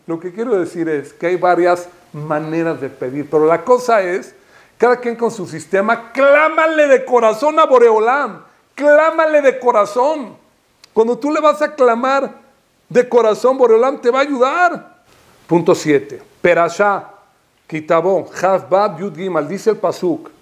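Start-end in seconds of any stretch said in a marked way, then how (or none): 6.1-15.0 high, raised exhortation; fervent sermon peak; impassioned preaching. Real, not acted. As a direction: urge the congregation to cry out. Preaching at full force.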